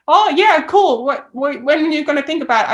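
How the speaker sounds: high, loud, mock-eager teasing. Teasing Americans who promise to visit, high and loud. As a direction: needle them with playful fake enthusiasm. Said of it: "I try and, like, mess with them".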